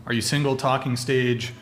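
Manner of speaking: ordinary talk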